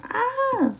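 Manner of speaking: sudden high yelp; pained yelp. Real, not acted. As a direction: yelp sharply. Something in her eye.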